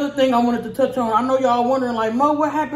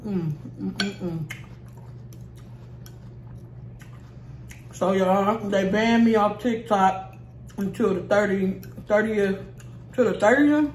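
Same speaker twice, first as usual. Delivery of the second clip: chewing between words; talking while chewing; 5 dB quieter than usual. Real, not acted. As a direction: mouth partly full of food. Seen 2: Took salad bite before; lips pursed, chewing between words while speaking.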